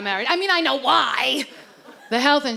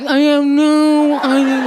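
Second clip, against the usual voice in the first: slow dopey buck-toothed mumble; hunched buck teeth husband; 2 semitones lower, 6 dB louder, slower than usual. Retold or imitated. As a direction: drag it out, hunched and dim. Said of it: "we hunch our back".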